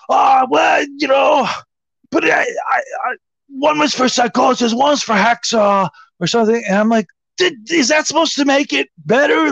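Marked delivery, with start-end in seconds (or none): 0.1-6.6 high-pitched, stammering self-defence; imitated sheepish excuses. Houseguest explaining where the other joints went, imitated high stammering excuses. 7.4-9.5 high, incredulous challenge; retold indignation. Host answering houseguest's excuse, high retold indignation.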